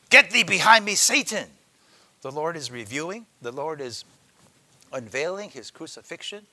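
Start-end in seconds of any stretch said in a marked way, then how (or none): 0.1-1.5 loud stern rebuke of Satan; the Lord's loud rebuke; rebuking voice. Acted. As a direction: command him away, hard and loud. Preacher voicing the Lord rebuking.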